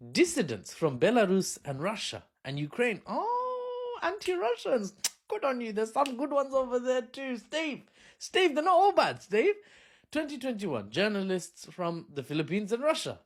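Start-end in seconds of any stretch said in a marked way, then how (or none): 3.0-9.5 much higher, sing-song mock praise; mocking Western pundit. Comedian mocks pro-Western cheer to Steve, high mocking gush.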